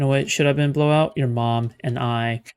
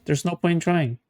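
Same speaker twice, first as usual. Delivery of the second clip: usual speaking voice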